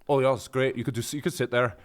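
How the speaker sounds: mock Scottish lilt slipping toward Northern Irish; playful, inexact Scottish impression; imitated Scottish accent, almost Northern Irish. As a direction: imitate a Scot, landing near Northern Irish. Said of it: "almost sounded Northern Irish there".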